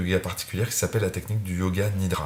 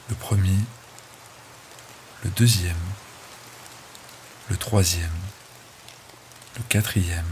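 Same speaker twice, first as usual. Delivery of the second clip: measured body-scan meditation voice, long pauses between items; name each body part calmly, leaving room to relax; unhurried yoga nidra guide, phrase by phrase; guided relaxation. Real, not acted.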